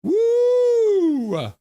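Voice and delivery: very high, loud whoop; cheering shout. Retold or imitated. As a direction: whoop it like a crowd doing the wave. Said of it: "Zach says".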